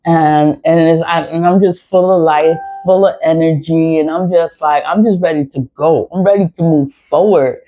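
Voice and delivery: ordinary delivery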